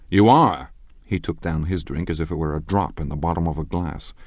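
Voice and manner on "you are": loud rising disbelief; can't believe what she said; incredulous. Acted. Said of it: "looked at her incredulously".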